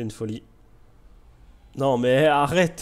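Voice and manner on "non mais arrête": loud, higher protest at being outbid; loud exasperated protest, slowed down; exasperated outcry. Real, not acted. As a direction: cry out in disbelief. Outbid at the auction.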